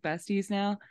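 ordinary talk

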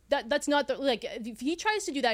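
ordinary delivery

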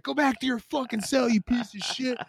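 imitating an angry guard; mock guard yelling. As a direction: bark it like a fed-up guard. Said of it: "They're like".

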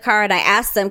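ordinary talk

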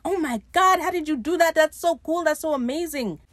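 high, loud fanboy gushing; gushing awe. Retold or imitated. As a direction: imitate someone totally eating it up. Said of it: "Steed is eating this up".

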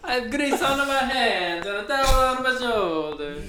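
high, loud, hammed-up Italian-American voice; Italian cook caricature. Joking with friends, hammed-up Italian cook voice. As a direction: play the greasy diner cook.

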